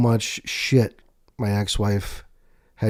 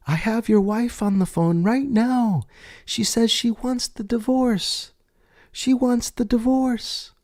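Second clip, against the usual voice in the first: a slow, high receptionist passing on news; 13 semitones higher, slower than usual. Retold or imitated. Receptionist relays ex-wife's call in a high slow voice.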